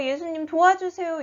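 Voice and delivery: pleading shout. A narrator's reading. Storyteller quotes blind man shouting to Jesus, pleading. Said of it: "소리 지르고".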